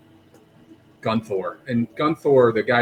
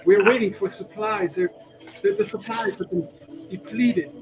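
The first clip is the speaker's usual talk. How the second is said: higher, eager villager; grateful villager; 6 semitones higher than usual. Acted. Villager to his rescuers, higher eager thanks. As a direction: press your thanks eagerly.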